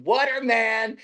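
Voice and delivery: high drawn-out repeated call; calling out again. Retold or imitated. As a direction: keep calling the name, insistent. Man calling again at the gate.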